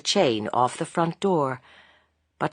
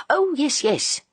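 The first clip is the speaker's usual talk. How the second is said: hasty; 10 semitones higher, slower than usual. A narrator's reading. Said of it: "said Missus Bunting hastily".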